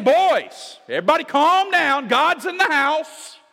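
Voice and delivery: a commanding, reassuring call across a storm; Jesus calming his disciples. Acted. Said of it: "He's saying".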